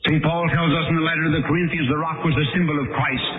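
high, rising sermon delivery; impassioned preaching. Acted. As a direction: preach it with mounting insistence.

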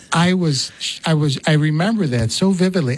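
usual speaking voice

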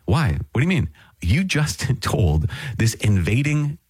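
the prophet scolding the king in disbelief; rebuking prophet. Retold or imitated. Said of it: "goes to Hezekiah and rebukes him".

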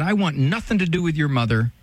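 usual speaking voice

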